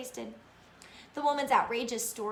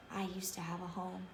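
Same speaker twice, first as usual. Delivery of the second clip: very soft, hushed confession; whisper; 2 semitones lower, 10 dB quieter than usual. A narrator's reading. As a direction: let it out barely aloud. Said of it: "Carter whispered".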